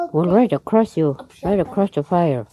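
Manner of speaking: mildly forceful, pressing the point; insistent order. Acted. Woodsman directs girl across the fire, mildly insistent and loud.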